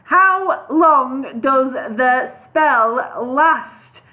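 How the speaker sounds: very slow, a gap between each word; slow, spaced words. Acted. Slow spaced words so the wizard hears. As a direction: space out every word for the deaf old wizard. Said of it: "leaving a space between each word".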